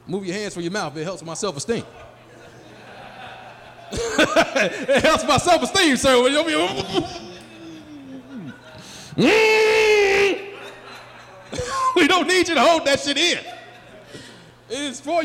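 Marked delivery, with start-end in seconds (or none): 3.9-6.4 high, loud laughing delivery; cracking up loudly at the audience. Real, not acted. Laughs while repeating line to audience. 6.5-11.0 long, high-pitched cackle; real uncontrolled laugh; loud laughing fit. Real, not acted. Cracks up at audience member.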